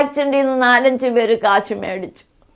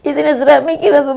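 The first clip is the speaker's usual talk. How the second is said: crying; 2 semitones higher, 8 dB louder than usual. Real, not acted. Mother pleads to dead son.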